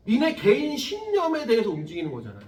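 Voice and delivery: usual speaking voice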